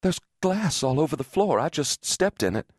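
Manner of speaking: high-pitched rising alarm; building panic. A narrator's reading. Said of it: "cold panic building".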